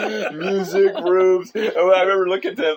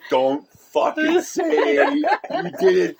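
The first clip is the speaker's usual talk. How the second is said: mock warning; 12 semitones higher, much slower than usual. Classmates warning each other.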